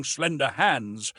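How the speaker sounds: ordinary delivery, nothing marked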